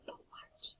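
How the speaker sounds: whispered commands; hushed game of whispered instructions. Shown as a demonstration. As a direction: whisper each action softly. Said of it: "in a whisper voice".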